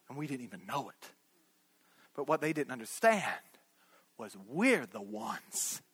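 low, soft, with long pauses; hushed preaching. Real, not acted. Preacher telling the congregation they can connect, low and hushed. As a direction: bring it right down, phrase by phrase.

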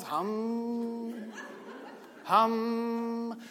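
soft, mock humming chant; mock meditation hum. Shown as a demonstration. The preacher mocking meditation with a soft hum. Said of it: "make some humming noise".

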